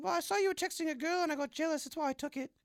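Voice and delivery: put-on high, bright girl voice; girl character voice. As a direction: pitch way up as the phone thief girl. Same girl character continues.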